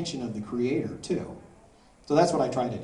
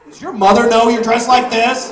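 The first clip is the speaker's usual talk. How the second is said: higher, louder, laughing reprimand; a scandalized father's outcry; shocked dad; 8 semitones higher, 13 dB louder than usual. Retold or imitated. Scolding question to the teen.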